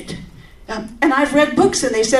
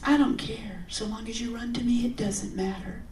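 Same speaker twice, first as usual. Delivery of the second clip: hushed whisper; 10 dB quieter than usual. Acted. God's gentle reply.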